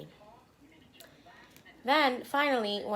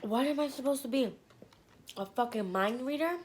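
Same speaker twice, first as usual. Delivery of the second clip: high-pitched indignant comeback; retold snap; 6 semitones higher than usual. Cranky passenger answers the cab driver back, snapping indignantly. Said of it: "So I snap".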